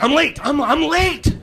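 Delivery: high-pitched, alarmed realisation; panicked waking. Acted. Employee jolting awake hours late for work, panicked.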